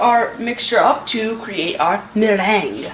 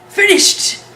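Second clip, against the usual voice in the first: excited call; 10 semitones higher, 5 dB louder than usual. Real, not acted. Announcing the chicken is done.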